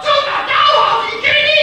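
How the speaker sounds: put-on high and loud, outraged child; high indignant outburst. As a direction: fire it back at dad in disbelief. Girl indignant at dad.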